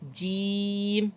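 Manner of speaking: जीम slow with lips spread; teacher models the thin letter; thin jeem, lips spread. Shown as a demonstration. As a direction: draw out जीम with a smile. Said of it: "अगला भी बारीक है".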